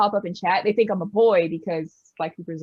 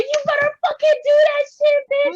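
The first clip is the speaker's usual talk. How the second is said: very high, loud playful yell; hype shout; 6 dB louder than usual. Retold or imitated. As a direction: scream it like an ecstatic hype girl. Hyping her friend.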